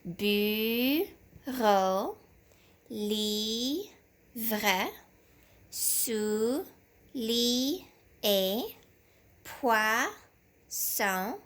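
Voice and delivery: "Bureau, livre, soulier, poisson, chambre" each split into beats with pauses; teacher modelling syllable cuts for a child; syllable chopping. Shown as a demonstration. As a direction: sound every word out slowly for the class. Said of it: "Coupons tous les mots ensemble".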